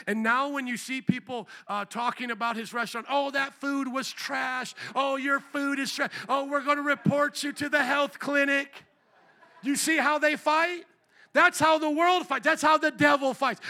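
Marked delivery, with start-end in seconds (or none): 3.1-8.9 high mocking grumble, building louder; mock outraged complaint. Imitating angry online food reviewers. 9.6-13.6 high, loud preacher's build; preaching at full force. Real, not acted.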